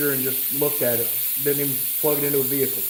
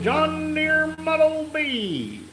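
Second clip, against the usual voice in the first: high, slow, long-held call; drawn-out holler; 12 semitones higher, much slower than usual. Acted. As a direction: stretch it out high on one held note. Acts out a chat message.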